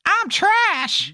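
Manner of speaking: very high cartoonish cry; mock character cry. Co-host joking about Toy Story four, high mock cry.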